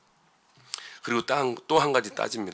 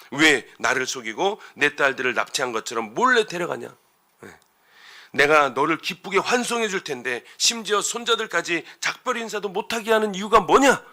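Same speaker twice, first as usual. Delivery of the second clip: acted demand, building louder and higher; confronting, accusing; 5 semitones higher, 6 dB louder than usual. Laban demanding why Jacob secretly took his daughters, accusing. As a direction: challenge him as an aggrieved Laban. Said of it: "야곱 만나자마자 따지는데요".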